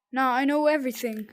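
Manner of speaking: loud, fast triumphant cry; shouting. Real, not acted. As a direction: shout it out quickly.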